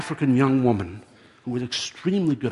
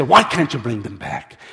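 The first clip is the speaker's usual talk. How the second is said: loud, forceful challenge; angry demand; 8 dB louder than usual. A narrator's reading. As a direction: demand it loudly of the old man. Drivers demand an explanation.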